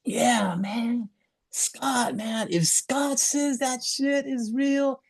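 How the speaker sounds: high, slow mock raspy voice of an old friend; hammed-up impression of a raspy local activist; raspy Brian impression. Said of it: "he said in his classic, you know, Brian Flagg, uh, raspy voice".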